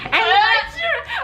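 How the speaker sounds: high, slow laughing shriek; laughing disbelief at being touched; shocked laughing cry. Real, not acted.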